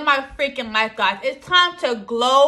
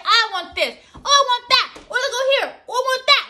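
higher, eager wanting voice; mock greedy excitement; 8 semitones higher than usual. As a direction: act out craving every item in the shop. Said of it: "I'm always like".

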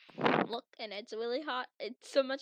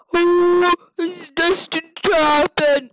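loud, slow, higher prophetic voice; dramatic proclamation; 5 semitones higher, 18 dB louder, much slower than usual. Acted. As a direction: declare it like an ominous oracle.

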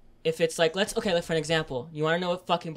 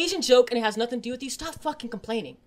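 higher, fast and pressing; heated rant; 7 semitones higher, faster than usual. Real, not acted. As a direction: push the point hard, half shouting. Keeps ranting at complainers.

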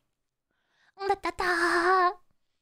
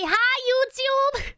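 drawn-out hi YouTube; slow sing-song greeting; 5 semitones higher, much slower than usual. Shown as a demonstration. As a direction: stretch it out for chat. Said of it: "Can you say".

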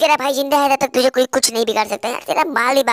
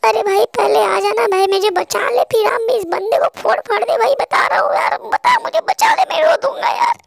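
very high, pleading, near-tears whine; high girlish voice whining at a friend to save her; whiny scared teammate; 11 semitones higher than usual. Acted. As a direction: beg to be rescued, climbing higher as panic grows. Teammate begs to be rescued.

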